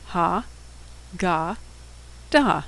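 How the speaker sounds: "Ha" "ga" "da" spoken one syllable at a time; syllable-by-syllable reading; sounding out syllables. Shown as a demonstration. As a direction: sound out each syllable slowly. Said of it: "so let's sound it out".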